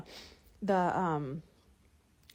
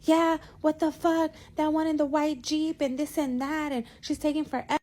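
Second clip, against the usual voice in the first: yelling homeless guy; 8 semitones higher than usual. Retold or imitated. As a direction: shout it like the rude guy in the drive-thru. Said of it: "He was like".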